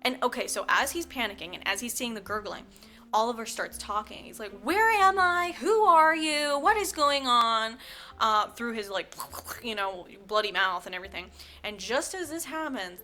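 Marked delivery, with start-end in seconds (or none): 4.6-7.8 loud, high, drawn-out wailing questions; hammed-up bewildered yelling; frantic confused cries. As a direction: cry out as if waking disoriented. Boy suddenly talks, screaming in confusion.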